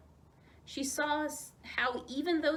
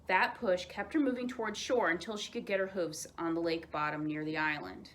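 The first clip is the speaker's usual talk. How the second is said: ordinary delivery, nothing marked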